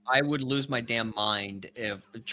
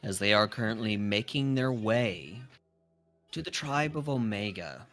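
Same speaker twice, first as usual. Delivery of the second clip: game master narration; 4 semitones lower than usual. Acted. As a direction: set the scene for the players, unhurried. DM narrates scene.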